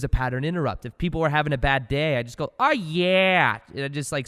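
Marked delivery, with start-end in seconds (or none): 2.6-3.6 loud, higher drawn-out "Oh, yeah"; hyped catchphrase. Retold or imitated. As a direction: cheer up a coworker with a silly shout. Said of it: "say it like that".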